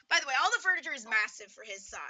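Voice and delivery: usual speaking voice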